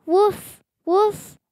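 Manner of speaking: high, bright little yips of a puppy; puppy woof. Acted. Reading a picture book aloud, puppy Biscuit barks with high yips. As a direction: yap it like a happy puppy.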